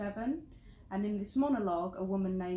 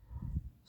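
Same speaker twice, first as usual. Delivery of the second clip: soft, breath-only sigh of disbelief; exasperated sigh; 8 dB quieter than usual. Acted. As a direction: let out a fed-up sigh. Acted exasperation after the story.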